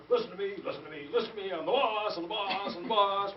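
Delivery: hammed-up bossy chant, every word accented; pompous, rhythmic insistence; self-important. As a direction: chant it like someone demanding attention. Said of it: "when they're self-important".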